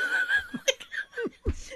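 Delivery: shrill burst of laughter; high squealing laugh. Real, not acted. Laughs after the impression.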